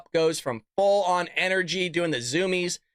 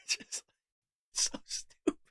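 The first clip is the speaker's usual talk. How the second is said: laughing whisper; 9 dB quieter, slower than usual. Real, not acted. Host laughing at clip.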